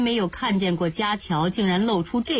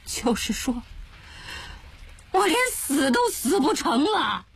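rising anguished lament; wretched despair; 8 semitones higher than usual. Acted. Jiaqiao realizes he cannot even die. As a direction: voice cracking at the end. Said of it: "凄惨地".

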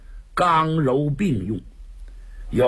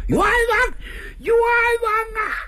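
crying out injustice. Acted. Prisoner cries out on cart.

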